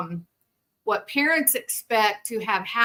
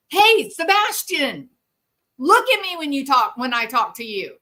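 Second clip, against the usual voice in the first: mock parent yell; 6 semitones higher, 5 dB louder than usual. Parent yelling at Sebastian to look at them, loud. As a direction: bark at the child to look at you. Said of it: "So then you scream and you say".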